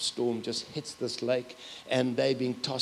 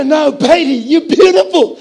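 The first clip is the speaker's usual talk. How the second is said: much higher and louder, insisting she's beautiful; insisting loudly; 13 semitones higher, 16 dB louder than usual. Retold or imitated. Grandpa insisting to his granddaughter, loud and high. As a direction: call it out big and high, won't take no.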